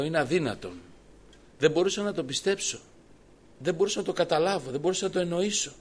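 preacher reliving his disbelief before the congregation; mounting disbelief. Real, not acted. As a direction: build the amazement phrase by phrase. Narrator's growing disbelief.

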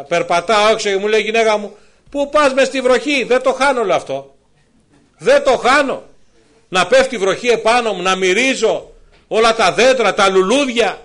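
high, loud rapturous testimony; preacher exulting loudly about walking in rain; fervent preaching. Acted. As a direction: preach it with wonder and force.

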